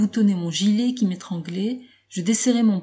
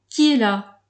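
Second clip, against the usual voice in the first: raised call through the door; cried out; 2 semitones higher, 4 dB louder than usual. A narrator's reading. Locked-in narrator calling to the door, raised voice. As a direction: call out who is there. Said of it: "Je criai".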